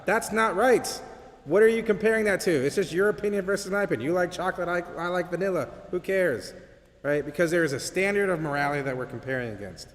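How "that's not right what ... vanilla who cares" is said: angered student. Retold or imitated. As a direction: argue it back at the professor, raised. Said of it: "The student immediately felt angered about it".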